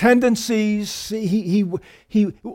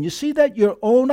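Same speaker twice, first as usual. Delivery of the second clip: ordinary delivery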